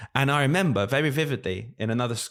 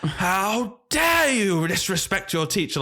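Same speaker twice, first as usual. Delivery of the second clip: high, slow, drawn-out scolding; mock outraged teacher; 8 semitones higher, much slower than usual. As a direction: imitate a scandalised teacher losing it. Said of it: "this teacher just lost it".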